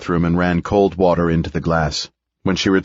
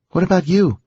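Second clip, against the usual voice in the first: worried woman; 11 semitones higher than usual. A narrator's reading. Edith voiced by narrator.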